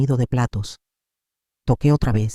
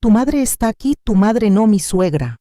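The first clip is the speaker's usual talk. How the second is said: calling out; 6 semitones higher than usual. A narrator's reading. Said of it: "Ramiro gritó hacia el pasillo".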